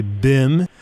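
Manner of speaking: mock French pronunciation of "bim"; French-accented bim. Shown as a demonstration. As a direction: give "bim" a French vowel. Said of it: "say it in a French accent".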